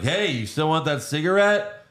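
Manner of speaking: mildly stagey, put-on nonchalance; bad actor. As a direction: imitate a poorly faked casual question. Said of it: "their characters aren't good actors".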